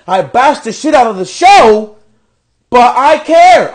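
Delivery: ordinary talk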